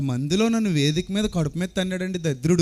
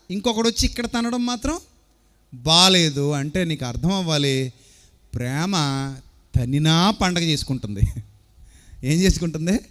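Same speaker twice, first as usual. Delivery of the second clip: ordinary talk, nothing marked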